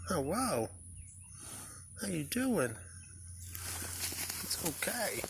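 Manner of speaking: high, soft coaxing voice; gentle animal coaxing. Real, not acted. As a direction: soothe a shy animal, high and quiet. Coaxing a cow to be petted.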